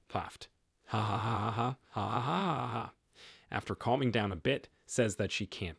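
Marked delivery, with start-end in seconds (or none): 0.9-2.9 big hearty belly laugh; loud laughter. Acted. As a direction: burst out laughing. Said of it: "bursts into loud laughter".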